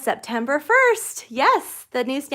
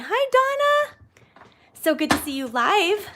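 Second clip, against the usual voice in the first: excited greeting; 10 semitones higher, 5 dB louder than usual. Real, not acted. Greeting a live viewer.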